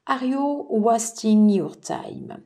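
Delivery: slow English with a French accent. Real, not acted. Tarot reader reading an English advice card, French-accented English. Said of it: "désolée pour mon accent anglais".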